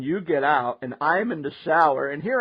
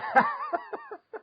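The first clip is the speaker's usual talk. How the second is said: very high squeaky laugh; helpless high giggle; 4 dB quieter than usual. Real, not acted. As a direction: laugh until the words give out. Laughing at the memory.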